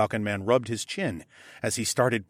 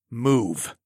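slow and pointed. A narrator's reading. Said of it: "spoke very slowly and pointedly".

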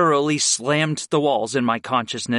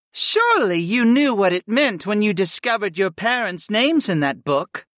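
goddess replying; 8 semitones higher than usual. A narrator's reading. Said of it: "Sarah replied".

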